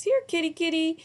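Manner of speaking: high, coaxing call to a kitten; calling a kitty. A narrator's reading. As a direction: coax the cat out.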